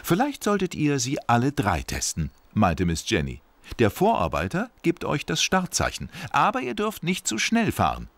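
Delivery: high, friendly supervisor voice; kind boss lady. Acted. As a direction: tell the engines the rules kindly.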